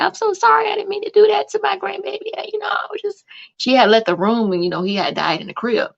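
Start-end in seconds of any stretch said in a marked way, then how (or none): 0.0-3.3 high, hushed whispered apology; imitating a crying grandmother. Retelling the grieving grandmother at the funeral, high whisper. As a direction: whisper it like a broken old lady. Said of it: "She's like".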